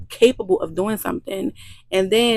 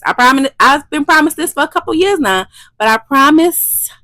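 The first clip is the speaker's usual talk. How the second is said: higher and louder, pleading promise; emphatic promise; 7 semitones higher, 8 dB louder than usual. Real, not acted. Vlogger promising viewers more content, loud and eager. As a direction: swear it to your viewers with gusto.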